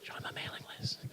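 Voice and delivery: fully whispered creepy invitation. Pretend. Speaker mocks sign-up forms, creepy whisper. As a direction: whisper it right in their ear, creepily. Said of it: "A little creepy".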